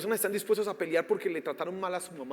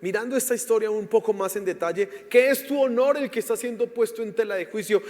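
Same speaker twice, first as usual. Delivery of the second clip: loud, higher, impassioned prayer; fervent prayer; 5 semitones higher, 9 dB louder than usual. Real, not acted. Pastor praying over the congregation, loud and fervent. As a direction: cry out to God with all your force.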